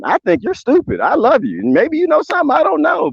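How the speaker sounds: normal talking voice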